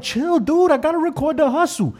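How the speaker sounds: very high-pitched retold objection; high, exasperated act of putting them off; mock protest. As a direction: squeal out a mock brush-off. His own quoted protest to fans.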